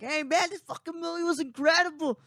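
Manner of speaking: mock screaming. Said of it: "I'm not screaming like".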